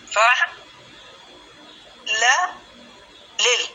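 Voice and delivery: "فع لا لل" as three isolated syllables with long pauses; syllable-by-syllable demo. Shown as a demonstration. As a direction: spell out "فع لا لل" one sound-chunk at a time. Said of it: "فتح الأول، سكون الثاني".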